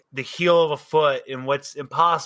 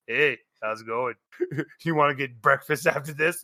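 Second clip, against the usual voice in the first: lower, offhand chat-up voice; casual guy in the pile; 4 semitones lower than usual. Acted. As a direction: ask it low and casual. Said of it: "The guy's just looking at him".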